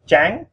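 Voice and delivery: Trắng pitched high and climbing; rising tone. Shown as a demonstration. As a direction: send Trắng sharply upward. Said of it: "So we go up".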